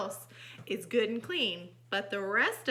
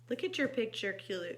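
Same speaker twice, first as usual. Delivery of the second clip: ordinary delivery, nothing marked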